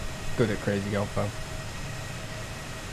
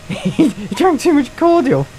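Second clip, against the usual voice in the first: laughing; 11 semitones higher, 4 dB louder, slower than usual. Real, not acted. Laughing while telling.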